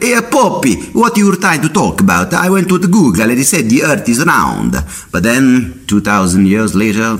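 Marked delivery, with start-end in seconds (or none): 0.0-4.7 high, cheeky mock Copernicus; cheeky Italian scientist. Copernicus teasing the Pope about Google, high cheeky voice. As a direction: tease the Pope in a squeaky voice. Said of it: "Maybe they're like".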